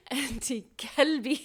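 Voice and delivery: 'anti kalbi' with a plain front k, meaning dog; Arabic kalbi. Shown as a demonstration. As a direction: say 'kalbi' with an English k, not the throat q. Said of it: "if you accidentally say kalb".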